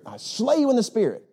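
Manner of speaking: high, theatrical mock preacher voice; mocking faith healer. Pastor mocking a showy healer, hammed-up high voice. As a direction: ham up a showy faith healer slaying people. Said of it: "there's pastors where they will slay the people".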